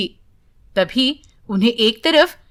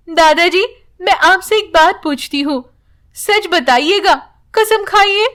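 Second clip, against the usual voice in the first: put-on higher, pleading childlike voice; young daughter's voice; 6 semitones higher than usual. Girl begs grandfather to swear, childlike higher voice. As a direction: lightly play a girl begging for the truth.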